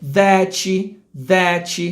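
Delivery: "that" pronounced "thatchy"; added final i. Shown as a demonstration. Teacher mimicking a Brazilian habit, "that" as "thatchy". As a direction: tack an "ee" onto the t of "that". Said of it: "manias de colocar um I depois".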